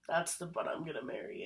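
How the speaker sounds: low-key retold confidence to a friend; confiding aside. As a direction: drop the volume as if nudging a coworker. Nudges coworker, confides a remark.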